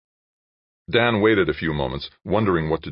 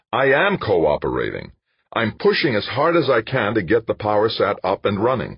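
heated protest; 7 semitones higher, 5 dB louder than usual. Acted. Dan protests in an argument.

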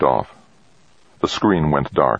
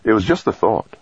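ordinary delivery

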